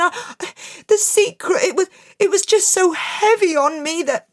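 high, stammering, anxious voice; nervous confession. Acted. Barber confessing in fear.

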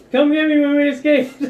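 high, loud panicky plea; cowardly squeal. Acted. Coward hiding in cabin calling out, high panicky plea. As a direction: squeal it from behind the door.

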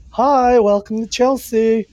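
high, slow, mincing welcome; lightly camp host greeting; a hint of a camp greeter; flamboyant greeter. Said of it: "the difference between Chelsea and the Village".

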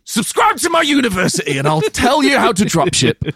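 high, pushy hustle-guru pitch; mock sell it like an online guru; Andrew Tate. Mocking a guru to his cohost, high hustler voice. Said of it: "All right, Andrew Tate".

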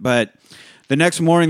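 usual speaking voice

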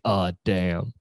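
ordinary delivery

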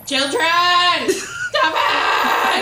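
high, exasperated parent shout; mock scolding. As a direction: break up the squabbling, high and mock-stern. Mock scolding of squabbling players.

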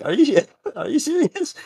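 high-pitched mock disbelief; incredulous. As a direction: squeal it in laughing disbelief. Acting his disbelief at her age.

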